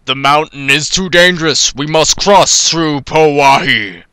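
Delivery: slow solemn heroic voice; a hint of a grave hero; character voice. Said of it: "and she went to the".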